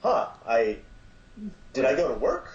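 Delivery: a hint of comic panic; a mock alarmed uh-oh; mock dismay. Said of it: "looked up a month later and said".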